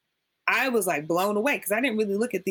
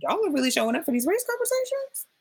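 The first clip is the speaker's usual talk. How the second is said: high, fast, incredulous question; squeaky mock-astonished question; mock disbelief; 10 semitones higher, faster than usual. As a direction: ask it in wide-eyed disbelief. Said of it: "And I was like".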